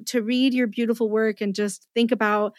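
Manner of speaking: normal talking voice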